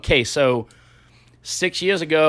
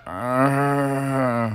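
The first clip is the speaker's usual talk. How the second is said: long, low moaning cat; softer, drawn-out mock animal groan; imitated cat moan; 4 semitones lower, 7 dB quieter than usual. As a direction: drone like an unhappy cat. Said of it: "Oh, it's moaning".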